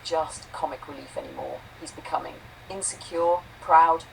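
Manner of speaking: ordinary talk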